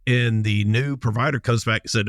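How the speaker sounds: ordinary talk, nothing marked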